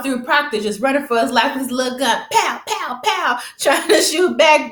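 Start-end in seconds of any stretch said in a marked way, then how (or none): 2.3-3.4 high punchy "pow" gun noises; imitated gunshots. Fan retelling a shootout, mimicked gunfire. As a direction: mimic a little gun popping off.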